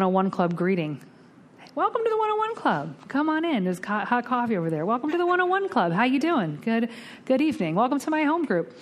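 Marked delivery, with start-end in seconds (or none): 1.7-8.7 high, bright door greeter welcoming everyone; cheery greeter. Retold or imitated. Greeting newcomers at the one oh one Club, high cheery welcome. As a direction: sing out the welcome like a host at the door.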